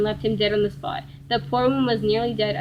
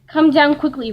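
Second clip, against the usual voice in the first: loud, higher storybook roar; 5 semitones higher, 8 dB louder than usual. A narrator's reading. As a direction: roar the order out. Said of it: "roared Blue Bear".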